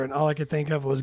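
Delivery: normal talking voice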